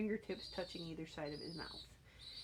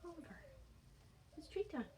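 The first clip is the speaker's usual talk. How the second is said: coaxing pet talk; 8 semitones higher, 9 dB quieter, faster than usual. Real, not acted. Coaxing bird toward a treat.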